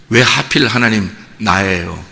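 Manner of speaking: low, soft, slow plaintive question; subdued, weary questioning of God; acted lament. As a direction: ask it softly and slowly, as a downcast Job. Job questions God in retelling.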